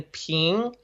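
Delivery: "ping" rising like a question; second tone. Shown as a demonstration. Teacher showing the rising second tone. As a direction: lift "ping" upward. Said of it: "second tone that goes up".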